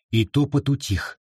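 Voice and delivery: plain reading voice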